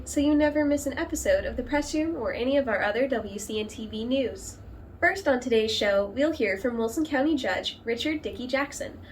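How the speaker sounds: loud, upbeat announcer read of the show intro; news intro read. Acted. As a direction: open the show like a local TV host. Host reads show intro.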